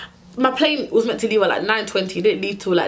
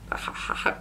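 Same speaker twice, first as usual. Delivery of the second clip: whispered in disbelief; stunned whisper; 10 dB quieter than usual. Retold or imitated. To airport staff about the missed plane, a stunned whisper. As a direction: breathe it out, too shocked to speak. Told the plane has left.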